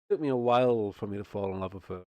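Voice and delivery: normal talking voice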